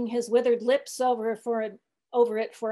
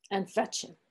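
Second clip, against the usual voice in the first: solemn statement; 4 dB quieter than usual. A narrator's reading. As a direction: finish gravely and softly. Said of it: "changing his whole air and demeanor".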